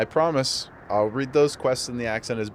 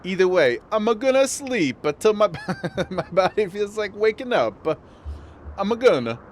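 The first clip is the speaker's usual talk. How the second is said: hammed-up Italian accent, pitched higher; mock Italian accent; 6 semitones higher than usual. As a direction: read the quest line like a cartoon Italian. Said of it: "like, in an Italian accent".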